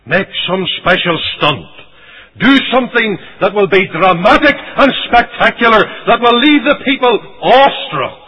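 high, loud preaching build-up; urging on. Retold or imitated. Brothers urging him to do something sensational, rousing. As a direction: build to a ringing, forceful climax. Acts those urging Christ to spectacle.

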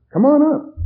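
high, loud call. Acted. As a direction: call her up the aisle. Host calls her up.

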